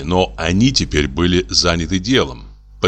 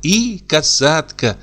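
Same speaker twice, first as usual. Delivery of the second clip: a girl lightly voicing her mother, higher; sing-song motherly storytelling, a little higher; imitating her mother; 7 semitones higher than usual. A narrator's reading. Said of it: "подражая своей матери".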